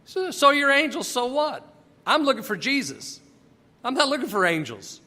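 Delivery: brushing off angels, impatient; so what, I want Jesus; defiant retort. Acted. Mary to the angels, impatient and dismissive.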